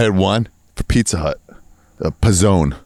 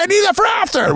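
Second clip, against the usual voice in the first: much higher, whiny protest; friend protesting. Retold or imitated. Friend whose passport copy was ripped, high and whiny. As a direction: squeal it like a betrayed buddy. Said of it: "He's like".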